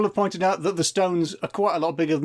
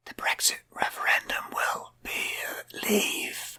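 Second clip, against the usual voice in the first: slow, higher, breathy whisper; 6 semitones higher, 7 dB quieter, slower than usual. Pretend. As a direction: whisper it like a stone prophecy. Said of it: "And they'd be like".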